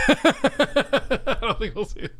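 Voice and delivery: mock-dramatic regret; mock lament. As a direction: stretch it out like a tragic sigh. Repeats line dramatically after laughing.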